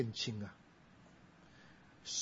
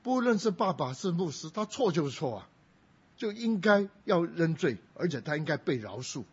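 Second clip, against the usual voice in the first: firm insistence; 5 semitones higher, 4 dB louder than usual. Retold or imitated. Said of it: "我说".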